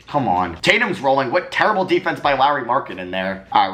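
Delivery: ordinary talk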